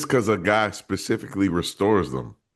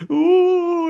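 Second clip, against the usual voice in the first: high, hooting ghostly ooh; spooky ghost voice; 5 dB louder than usual. Shown as a demonstration. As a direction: moan it like a cartoon ghost. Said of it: "It wasn't even, like, in a spooky voice".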